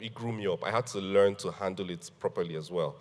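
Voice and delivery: normal talking voice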